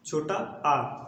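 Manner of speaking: छोटा आ said very slowly, the vowel held long; drawn-out vowel demo. Shown as a demonstration. Teacher slowly shows the students how छोटा आ sounds, drawn-out. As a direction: stretch out छोटा आ slowly for the class. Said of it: "तो जैसे कहेंगे आ".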